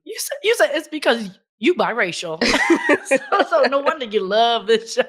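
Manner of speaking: teasing through laughter; laughing. Real, not acted. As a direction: laugh as you tease her. Teasing friend while laughing.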